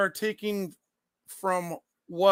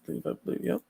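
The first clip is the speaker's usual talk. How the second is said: normal talking voice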